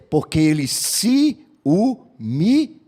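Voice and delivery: slow, weighty sermon close; preaching conclusion. Acted. Pastor driving home the moral to the congregation, slow and weighty. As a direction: let each word land slowly like a final verdict.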